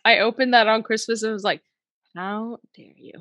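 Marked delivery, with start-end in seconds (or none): 2.1-3.1 "How dare you?" much softer, nearly under the breath; mock-offended, said barely above a murmur; quiet mock outrage. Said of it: "Just that quiet too".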